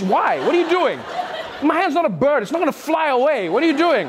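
high-pitched, exasperated comic protest; baffled protest. Acted. Comedian objecting to a two-handed handshake, exasperated. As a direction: protest in mock bewilderment, pitch jumping up. Acted protest at hand-sandwich shaker.

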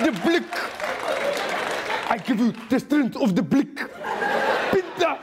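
hammed-up African accent, mangled vowels; bad movie accent. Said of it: "bad accents together".

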